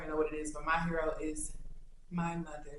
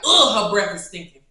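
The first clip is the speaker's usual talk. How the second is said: disgusted; 3 semitones higher, 14 dB louder than usual. Pretend. Imagined others judging her.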